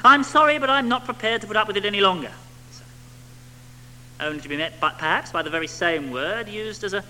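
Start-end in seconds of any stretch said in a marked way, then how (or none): 0.1-2.3 high, loud, exasperated snap; 높고 크게, 짜증 섞어 빠르게 쏘아붙이듯. Retold or imitated. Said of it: "sigh of exasperation".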